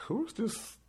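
a high, disbelieving retold question; mock outrage at the stranger; high then rushed, half-joking disbelief; incredulous. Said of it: "I'm just like".

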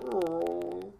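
soft imitated puppy whimper; long, soft mock whine; little-dog whine. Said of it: "he kinda whines like a little dog".

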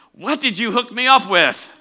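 high, loud voice of a dismayed date. Retold or imitated. A preacher voicing a disappointed date from the ad, high and loud. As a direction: make him sound stuck with a bad match. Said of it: "He's going".

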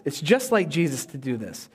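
ordinary talk

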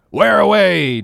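sharp, high, loud demand up to the masthead; captain's demand. Acted. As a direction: bark the question upward. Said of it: "demanded the captain".

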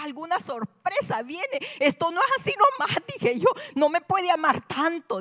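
high, loud incredulous exclaiming; overwhelmed disbelief. Acted. Woman asking God what surprise is coming, overwhelmed disbelief. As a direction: exclaim it as if too good to be true. Acted disbelief at such love.

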